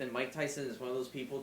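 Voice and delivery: ordinary talk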